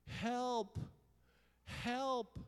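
high, loud cry for help; big re-enacted yell for help. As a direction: yell out as if lost on a mountain. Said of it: "I started yelling".

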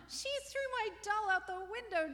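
high, loud accusing scream; screaming child. A narrator's reading. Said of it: "Julie screamed".

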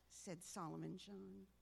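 usual speaking voice

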